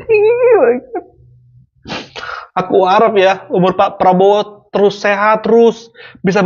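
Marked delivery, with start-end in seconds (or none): 0.1-1.1 mock crying. Vlogger mock-weeps over the video.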